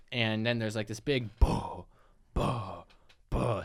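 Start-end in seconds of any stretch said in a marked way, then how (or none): 1.4-3.6 low, spaced-out booms; imitated booming sound. Host imitates the big sound in the back, booming. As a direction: imitate heavy thuds in the distance. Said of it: "sound in the back".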